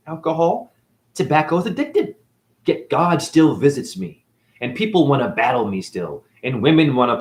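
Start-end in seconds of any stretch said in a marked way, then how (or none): none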